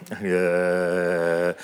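long, low, level "uh"; zombie drone. Acted. Preacher shows the congregation zombie land, droning.

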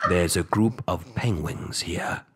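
low, hushed British narrator; British accent. Acted. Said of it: "we know he's from Britain".

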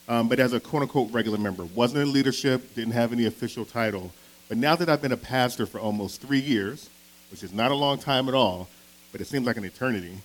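ordinary talk

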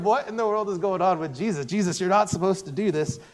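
lightly voiced flustered followers; baffled disciples. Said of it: "the disciples are going".

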